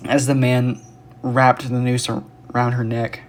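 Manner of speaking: plain reading voice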